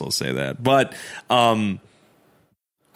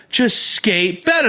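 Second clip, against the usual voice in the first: pitched up, lively; higher excited repeat; 9 semitones higher, 3 dB louder than usual. Retold or imitated. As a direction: say it brighter and higher.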